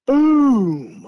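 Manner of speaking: a sudden high, loud boom; imitated boom. Something slaps the cabin at night, loud imitated boom. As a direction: punch the sound like a hit on the wall.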